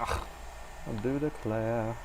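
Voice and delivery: mock genteel drawl, slow and soft; drawn-out Southern belle. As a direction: savour it like a swooning lady. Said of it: "I don't know why I keep saying that".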